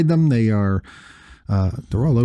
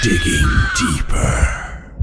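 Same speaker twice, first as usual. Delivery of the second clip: spooky whisper; much slower than usual. Pretend. Segment jingle voice.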